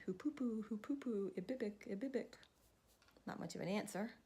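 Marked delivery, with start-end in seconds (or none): 0.0-2.3 light sing-song hoopoe call; bird call. Acted. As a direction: chirp it like the bird. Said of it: "And the bird said".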